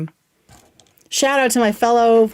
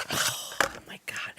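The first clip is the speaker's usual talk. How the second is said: slow breathless whisper; hushed and slow, amazed by the blush; awed whisper; 15 dB quieter, slower than usual. Real, not acted. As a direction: breathe it out in awe. Awed reaction to the heavy blush.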